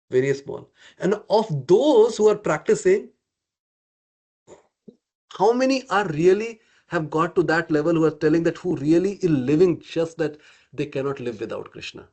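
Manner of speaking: ordinary talk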